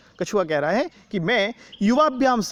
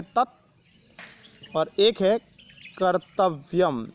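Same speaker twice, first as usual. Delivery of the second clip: very slow, word by word; slow word split; much slower than usual. Shown as a demonstration. Teacher splits तत् Kartavyam for the class, slowly. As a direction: spell out the two words slowly for students. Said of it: "तत् कर्तव्यम में दो शब्द हैं".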